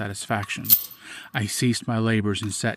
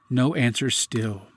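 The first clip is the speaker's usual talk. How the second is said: plain reading voice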